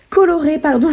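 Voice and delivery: short of breath, gasping slightly; running out of air mid-sentence. Real, not acted. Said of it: "j'ai plus de souffle".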